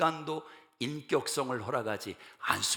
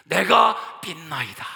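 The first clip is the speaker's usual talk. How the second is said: slow, loud anguished outcry; loud, slowed, pleading shout; father's desperate cry; 4 semitones higher, 10 dB louder, slower than usual. Acted. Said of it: "어떤 소리를 질렀습니까?".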